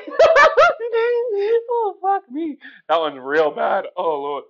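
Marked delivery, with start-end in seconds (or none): 0.0-2.6 high, loud helpless laugh through the words; squealing with laughter; breaking up laughing. Real, not acted. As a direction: lose it mid-impression. Cracks up at own impression.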